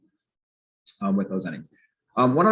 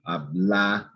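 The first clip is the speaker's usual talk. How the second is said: "hablara" broken out with the weight on "la"; stress before the R. Shown as a demonstration. Teacher points out the inflection, "hablara" stressed on "la". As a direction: lean on the middle a of "hablara". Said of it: "the inflection is here".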